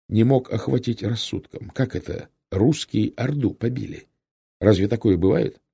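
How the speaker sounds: plain reading voice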